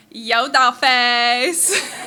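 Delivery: loud, drawn-out twang; twangy greeting. Shown as a demonstration. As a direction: stretch it out with a heavy twang. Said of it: "the more twang on it".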